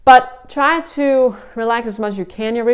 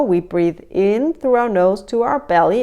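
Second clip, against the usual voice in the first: normal talking voice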